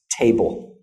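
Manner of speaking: table with a little burst of air on the t; aspirated t in table. Shown as a demonstration. Teacher asking students to hear the t. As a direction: let the t of table pop with air. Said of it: "Do you hear a little t".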